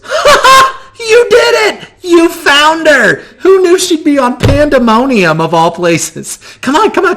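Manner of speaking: high, loud, laughing and eager; Gal's giddy laughing voice. Acted. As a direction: squeal with joy at finding her. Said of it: "And Gal goes".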